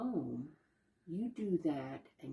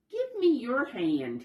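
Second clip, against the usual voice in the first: higher, louder little raccoon asking; eager child; 10 semitones higher, 6 dB louder than usual. Acted. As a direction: ask like an excited little kid, voice lifted and louder. Child Chester asks his mother.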